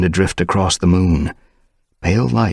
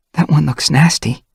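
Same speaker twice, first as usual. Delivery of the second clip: high, louder worried remark; concerned young she-cat; 11 semitones higher, 5 dB louder than usual. Acted. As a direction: wince at the wound. Dovepaw concerned.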